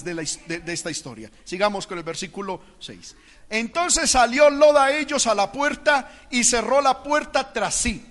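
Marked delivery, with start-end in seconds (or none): 3.5-8.0 slow, raised, declaimed verse; dramatic scripture reading. A narrator's reading. Preacher reading Lot's story to church, declaimed reading. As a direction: read the verse out slowly and high.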